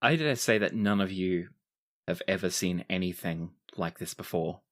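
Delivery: ordinary talk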